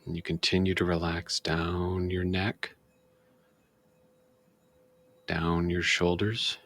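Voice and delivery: slow, calm meditation guide voice with long pauses; guided meditation. Shown as a demonstration. Demonstrating guided meditation for viewers, slow soothing guide voice. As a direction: ease the listener down, body part by body part. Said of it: "example of how we do guided meditation".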